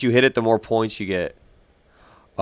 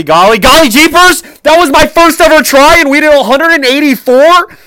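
shrieking high and loud; sledding downhill; 12 dB louder than usual. Real, not acted. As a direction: squeal with thrilled disbelief. Screaming after first run score.

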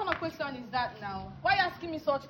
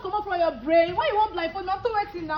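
heated scolding; 3 semitones higher, 4 dB louder than usual. Real, not acted. Woman scolding man over blindfold idea, angry. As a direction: tell them off with rising outrage.

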